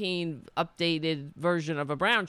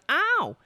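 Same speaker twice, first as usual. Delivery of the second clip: loud mock yelp of surprise; high startled cry; 12 semitones higher, 5 dB louder than usual. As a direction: imitate an old lady's high yelp. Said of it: "she goes".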